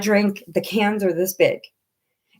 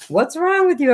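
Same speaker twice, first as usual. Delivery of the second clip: high, concerned mom's question; worried mom; 9 semitones higher than usual. Retold or imitated. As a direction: mom alarmed at swollen eyes. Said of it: "She's like".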